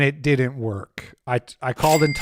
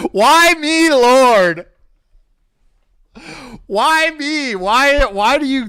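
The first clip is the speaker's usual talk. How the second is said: much higher, louder mock lament; hammed-up wail; 11 semitones higher, 7 dB louder, slower than usual. Streamer melodramatically begs the Lord why him, wailing high and loud. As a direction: wail to the heavens like a martyr.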